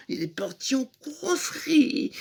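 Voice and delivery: ordinary talk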